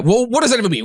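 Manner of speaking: mock in-your-face questioning; confrontational. Stranger getting in his face over the answer, confrontational. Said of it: "getting in my face".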